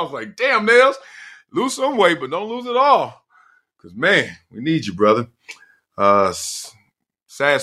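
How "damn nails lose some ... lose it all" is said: high teasing plea. Retold or imitated. Teasing friend quoted.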